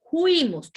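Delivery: "fuimos" said as "juimos", F turned to a J sound; vulgar juimos. Shown as a demonstration. Teacher mimics slangy speech for class, juimos with a J. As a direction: say "fuimos" with a J instead of the F. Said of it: "se nos vuelve muletilla".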